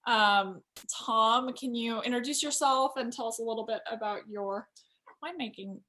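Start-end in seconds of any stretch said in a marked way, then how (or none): none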